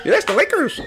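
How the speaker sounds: high-pitched, excitable footballer impression; Mbappe. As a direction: squeal it through laughter. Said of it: "what about Mbappe?".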